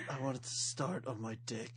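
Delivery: low, soft tough-guy voice; Snake impression. Tough prisoner asking tattoo artist for snake, low and soft. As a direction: drop the voice low and quiet like a hard man. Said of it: "Amazing snake imitation".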